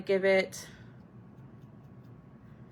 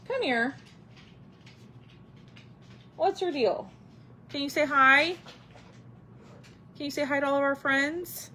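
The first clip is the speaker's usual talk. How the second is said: higher, sing-song pet voice; talking to the dog; 5 semitones higher than usual. Real, not acted. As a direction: coax the dog to greet viewers.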